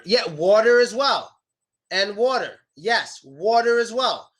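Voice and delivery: usual speaking voice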